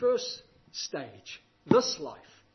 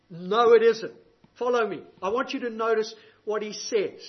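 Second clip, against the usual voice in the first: ordinary talk, nothing marked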